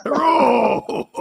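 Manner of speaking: high, stretched greeting in fake Chinese accent; hammed-up caricature hello; mock Chinese accent. Host repeating his joke greeting, mock Chinese accent.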